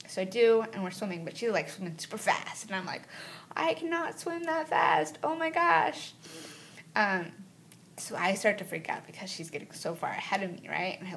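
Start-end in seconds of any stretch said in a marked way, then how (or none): 3.5-6.1 high, slow, breathless panic; panicked. Retold or imitated. Tired swimmer falling behind her buddy, high and panicky. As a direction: she can't keep up and it scares her.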